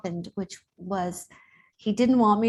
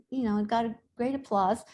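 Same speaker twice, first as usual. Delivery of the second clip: ordinary delivery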